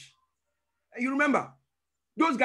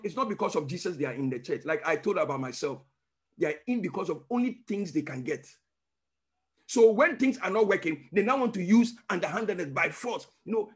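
ordinary talk, nothing marked

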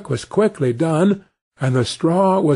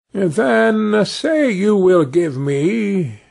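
sly little man; 6 semitones higher, much slower than usual. A narrator's reading. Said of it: "said the little man".

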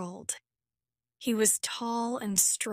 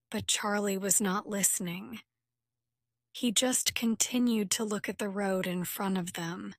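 ordinary delivery, nothing marked

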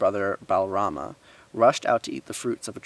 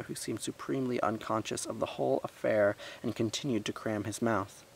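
plain reading voice

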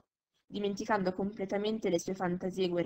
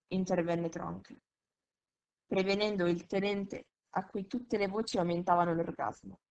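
ordinary delivery

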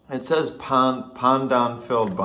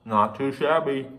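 talking while chewing bun; words muffled by food; mouth full of food; 2 semitones higher than usual. Real, not acted. Seen 3: Food visible in open mouth at 0.1–0.6; chewing beforehand.

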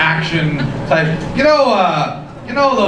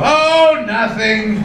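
mock lover's cry; 7 semitones higher, much slower than usual. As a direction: start high and passionate, then sink. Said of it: "She used to lie there and go".

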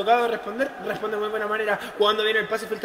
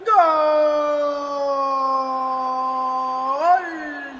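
breakneck goal commentary; 5 dB louder, much slower than usual. Acted. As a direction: rattle off the goals, then hold it. Goal call.

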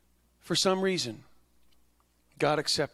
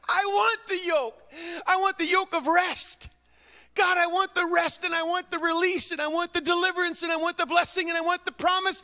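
mock whiny believer; 13 semitones higher, 3 dB louder than usual. Mocking a greedy believer's plea.

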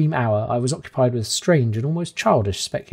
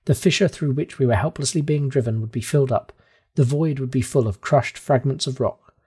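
ordinary delivery, nothing marked

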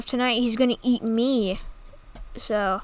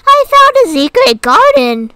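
high, loud, delighted cat voice; cat in the story squeals with delight; 10 semitones higher, 10 dB louder than usual. Acted. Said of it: "said the cat with much delight".

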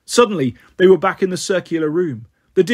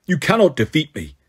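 growling. Acted. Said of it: "he growled".

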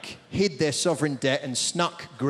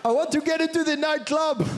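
high, excited mock Greek accent; bad Greek accent, high and giddy; 8 semitones higher than usual. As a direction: squeal it like an eager Greek clubber. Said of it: "I'm not very good at the Greek accent".

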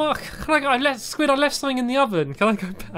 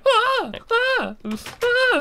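mock battle cry; much slower than usual. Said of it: "That's a very manly battle cry".

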